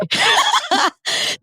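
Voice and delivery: high burst of laughter; sudden high shriek of a laugh. Real, not acted. Loud laugh at joke.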